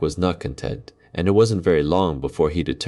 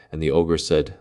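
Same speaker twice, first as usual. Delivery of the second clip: usual speaking voice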